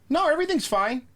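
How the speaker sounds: very high put-on reassurance; falsely fine cashier. Cashier covers up to her manager, mock high innocence. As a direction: pretend all is well, voice way up.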